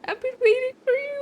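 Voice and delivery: high, playful mock-romantic line; lightly voiced fan-crush line; cheesy fan line. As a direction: squeal it like a swooning fan. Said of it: "but I'm gonna say this".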